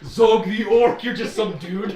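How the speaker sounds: pirate belittling the orc, giggling; laughing. Acted. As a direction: drag out the orc's name while laughing. NPC mocks orc, laughing.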